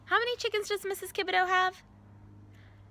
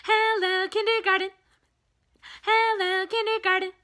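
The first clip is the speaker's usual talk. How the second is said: quick and loud; 5 semitones higher, 3 dB louder than usual. Shown as a demonstration. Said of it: "Too easy".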